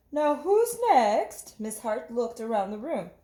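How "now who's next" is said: higher, slow teacher's question. Acted. Miss Hart asking her class, slow higher teacher voice. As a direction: ask the class like a kind teacher.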